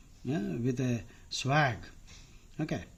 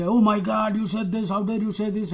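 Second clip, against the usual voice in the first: higher, indignant mock outburst; drama queen; 4 semitones higher than usual. Teacher imitating drama queens who throw a fit, melodramatic. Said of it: "drama queens and drama queens".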